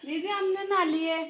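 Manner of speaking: loud, indignant protest; loud denial. Real, not acted. Younger sister loudly denies taking her sister's money. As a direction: insist you didn't take it.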